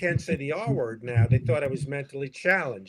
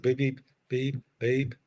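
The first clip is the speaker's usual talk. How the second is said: imitated beeps. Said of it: "I hear, like, a heartbeat".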